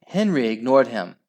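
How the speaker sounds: ordinary delivery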